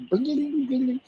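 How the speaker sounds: very high trilled blings; quick high mallet flourish; imitated xylophone. Shown as a demonstration. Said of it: "the xylophone".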